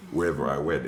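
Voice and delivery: ordinary talk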